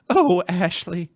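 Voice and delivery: high, loud, slow swooning Southern belle; drawn-out swooning belle sigh; Scarlett O'Hara. Retold or imitated. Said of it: "my Scarlett O'Hara impression".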